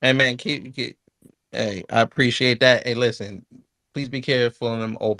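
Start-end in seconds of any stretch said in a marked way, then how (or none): none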